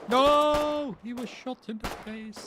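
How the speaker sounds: loud, high cry of dismay; shocked shout. Real, not acted. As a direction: react to a teammate going down. Teammate shot in game.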